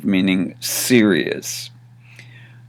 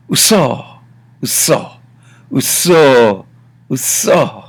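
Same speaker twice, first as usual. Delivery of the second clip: disbelieving exclamation; 3 semitones higher than usual. Shown as a demonstration. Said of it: "You're kidding. No way".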